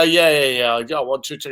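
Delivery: imitating an unenthusiastic caller; monotone. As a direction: agree with no excitement, dropping away. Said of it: "monotone, no excitement".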